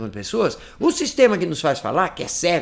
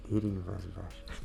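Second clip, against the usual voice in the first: much softer, close and private; intimate low voice; 8 semitones lower, 14 dB quieter than usual. Shown as a demonstration. Teacher demonstrates hand-conduit self-listening, intimate low voice. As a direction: murmur it to yourself. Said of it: "essa voz íntima".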